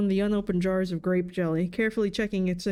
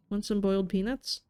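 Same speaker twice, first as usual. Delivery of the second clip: ordinary delivery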